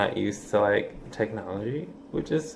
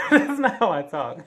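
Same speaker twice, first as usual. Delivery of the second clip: laughing through the words, higher; 9 semitones higher than usual. Real, not acted. Vlogger laughs at his own fake accent, laughing. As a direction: crack up at yourself.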